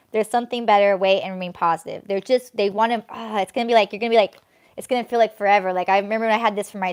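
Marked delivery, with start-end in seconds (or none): none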